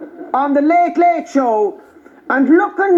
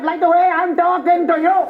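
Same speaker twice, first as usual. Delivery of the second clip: retold line in an Indian accent; mock Indian accent. Indian doctor explains his complaint, imitated Indian accent. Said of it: "this Indian doctor came out".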